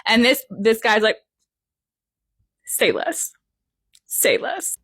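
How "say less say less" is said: breathless whispered Say less; eager whispered yes. Retold or imitated. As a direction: hush it out eagerly, all air. Eager agreement whispered back.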